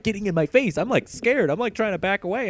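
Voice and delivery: normal talking voice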